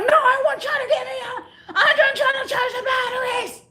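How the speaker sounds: very high-pitched mock customer yelling; retold yelling. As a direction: screech it as the frantic customer. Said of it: "he starts yelling, like, yelling".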